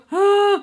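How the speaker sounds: quick high gasp of excitement; sharp excited gasp. Real, not acted. Creator to viewers before collab news, excited gasp. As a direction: gasp like you just remembered big news.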